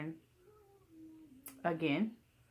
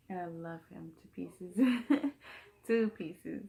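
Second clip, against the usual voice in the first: light affectionate laugh; laughing fondly. Real, not acted. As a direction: laugh it out, doting. Doting on baby.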